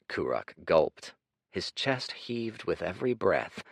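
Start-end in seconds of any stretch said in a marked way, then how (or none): none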